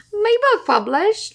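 high, slow Cockney mimicry; hammed-up Cockney imitation; mock Cockney. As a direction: tease with an exaggerated Cockney echo. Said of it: "You went a little Cockney".

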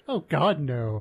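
imitating an emphatic, knee-jerk no; retold emphatic denial. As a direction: blurt out a mock-horrified denial, then drop. Retold blurted denial of liking hearts.

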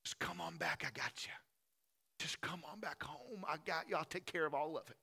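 soft, gentle coaxing to come home; hushed, reassuring call home; tender father. Acted. Father gently calling his son back home, softly reassuring. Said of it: "He's up there saying".

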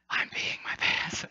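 slow, hushed whispered confession; confiding whisper. Retold or imitated. Embarrassed boy confesses to his mom, whispering. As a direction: whisper it so only mom hears.

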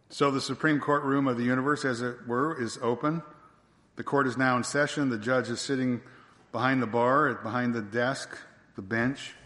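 usual speaking voice

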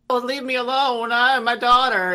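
high, loud melodramatic whine; mock wailing. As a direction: wail it like a hammed-up distraught witch. Mimics wailing witch in game.

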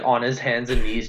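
ordinary talk

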